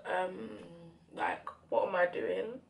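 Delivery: ordinary talk, nothing marked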